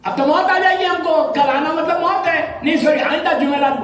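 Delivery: high, frightened scolding of the friend; panicked pleading. Retold or imitated. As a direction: blame him in mock terror. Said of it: "رونے لگے، معافی مانگنے لگے". (ڈرے ہوئے لہجے میں دوست کو کوسنا)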